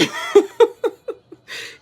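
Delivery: a quick airy laugh at herself; breathy high laugh. Real, not acted. Laughing at her own complaint about aging, breathy laugh.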